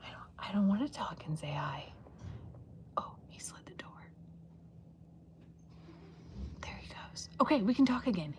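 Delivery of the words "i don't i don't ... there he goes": soft unvoiced whisper. Real, not acted. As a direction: keep it hushed until he leaves. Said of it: "I feel like I have to whisper".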